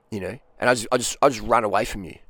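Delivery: ordinary talk, nothing marked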